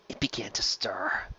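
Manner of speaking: breathy whispered dread; whispered menace; ominous whisper. A narrator's reading. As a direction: hush it like a secret. Ominous horror narration.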